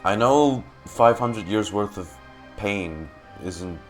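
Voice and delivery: normal talking voice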